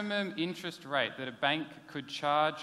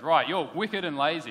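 a stern boss's louder telling-off; brisk, louder mock reprimand on "Right"; 2 semitones higher, 9 dB louder than usual. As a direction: play the boss scolding you in his office. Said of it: "The boss ever calls you into his office and goes".

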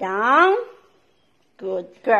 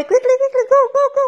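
much higher and louder, eager hurry-up; excited urging; 10 semitones higher, 4 dB louder, much faster than usual. Real, not acted. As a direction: rev the dog up, high and keen. Urging dog to find toy.

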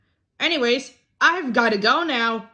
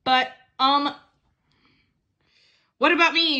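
usual speaking voice

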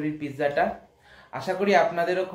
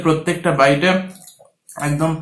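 talking between bites; chewing; 12 dB louder than usual. Real, not acted. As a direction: mouth partly full of pizza. Seen 1: Just bit pizza; food bits at lips, jaw working while speaking.

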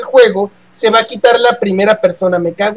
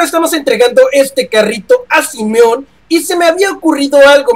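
usual speaking voice

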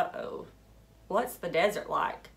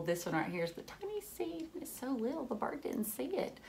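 ordinary delivery, nothing marked

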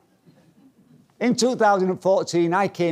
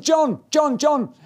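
high, louder repeated calls; mock panic; 12 semitones higher, 4 dB louder, much slower than usual. Pupil calls instructor mid-flight, retold panicky calling. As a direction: urgently call for the instructor, acted alarm.

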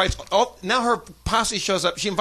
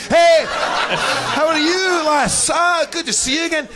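loud, high, hearty Scots greeting; stretched-out, booming Scottish hello; Scottish accent; 10 semitones higher, 5 dB louder, much slower than usual. Retold or imitated. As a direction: hail her in an overdone Scottish brogue. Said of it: "Oh, fuck, I'm Scottish".

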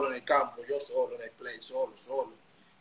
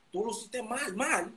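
high mock taunt; a teasing boss, pitched up; 7 semitones higher, 3 dB quieter, slower than usual. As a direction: lightly tease him for doing badly. Boss mocking, repeated.

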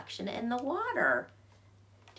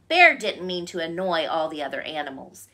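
ordinary delivery

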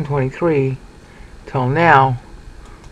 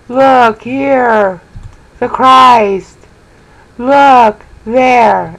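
announcing false Christ; 9 semitones higher, 8 dB louder, much slower than usual. Retold or imitated.

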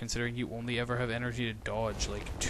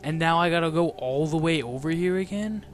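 loud, high frustrated complaint; frustrated rant; 5 semitones higher, 4 dB louder than usual. Real, not acted. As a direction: complain loudly in disbelief. Frustrated after death.

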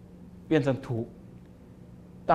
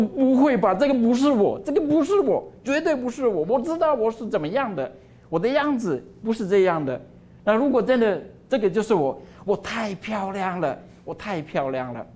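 astonished disbelief; 6 semitones higher than usual. Acted. Cup sees its reflection and cries out, high and amazed. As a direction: gasp it out, can't believe it's you.

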